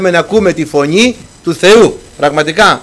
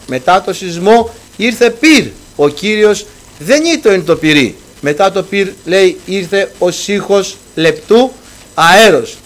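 higher, louder sermon build on the wind, quake and fire; rising preaching; 3 semitones higher, 4 dB louder than usual. Acted. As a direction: drive the story home from the pulpit. Preaching climax on Elijah. (κήρυγμα σε έξαρση, πιο ψηλά και δυνατά)

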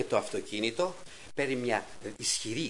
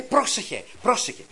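anxious warning; 7 semitones higher, 4 dB louder than usual. Retold or imitated. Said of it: "και φοβάται".

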